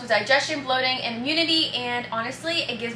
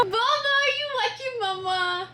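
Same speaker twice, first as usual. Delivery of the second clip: cooing pet talk; 11 semitones higher than usual. Real, not acted. Vlogger coos at her dog Pickle, high baby talk. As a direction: coo up high like talking to a puppy.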